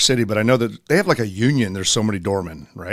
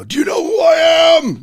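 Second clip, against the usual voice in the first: mimicking an entitled guy yelling, much higher and louder; mock angry shout; 5 dB louder than usual. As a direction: shout it like a furious trapped frat bro. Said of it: "these guys were getting, like, mad".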